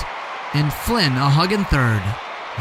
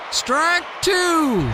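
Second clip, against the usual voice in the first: much higher shouted call; umpire shouting. Acted. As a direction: bark the call across the field. Umpire calls the strike.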